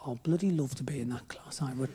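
hushed, breathy whisper of a guilty wish; whispered longing. Retold or imitated. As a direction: whisper it like a secret confession. Secret wish voiced as a thought.

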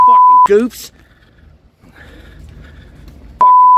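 furious high yell; angry shout. Real, not acted. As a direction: yell it after them. Angry man shouting after cops leave.

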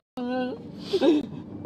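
choked crying sobs between words; real sobbing. Real, not acted. Girlfriend sobbing as her boyfriend threatens her mom. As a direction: sob helplessly while trying to speak.

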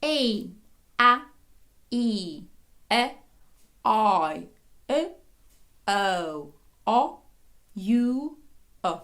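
long and short vowels alternating, each separate; vowel warm-up drill. Shown as a demonstration. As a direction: recite drawn-out vowel, then its short partner. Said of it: "І знов від початку". (розминка: довгий звук, потім короткий)

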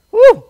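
a high, loud preacher's whoop of joy; sudden high, loud whoop; exultant woo. Real, not acted.

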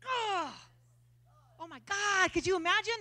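normal talking voice

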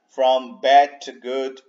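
normal talking voice

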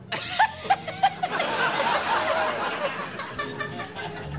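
high, strained, fake-sounding laugh; forced practice laugh. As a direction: squeeze out a forced, awkward laugh. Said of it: "Do a wee practice laugh here".

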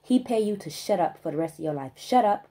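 usual speaking voice

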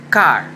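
"car" said as English "kar"; model English word. Shown as a demonstration. Teacher modeling "car" for kids to repeat, loud. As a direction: say "car" clearly and loudly. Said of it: "diga otra vez".

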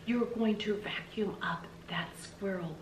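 slow whisper to herself; whispered echo. Acted. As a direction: breathe the words back in wonder. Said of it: "seeing them hanging there over her head".

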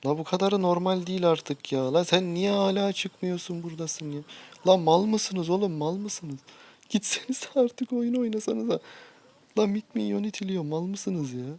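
high, loud scolding of teammates; furious exasperation. Real, not acted. Gamer scolds teammates who won't leave, high and furious. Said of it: "La vallahi çıldıracağım ya".

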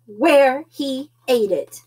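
ordinary delivery, nothing marked

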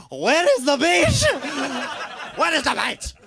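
put-on high, slow laughing Spanish accent. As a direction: play a Spanish clubber. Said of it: "That's my Spanish accent".

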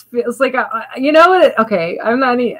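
ordinary delivery

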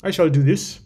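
a playful hint of a French accent on the move. Chess player announcing his move in a mock French accent. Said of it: "started speaking with a French kind of accent".

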